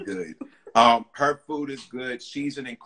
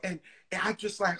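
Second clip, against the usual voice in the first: ordinary talk, nothing marked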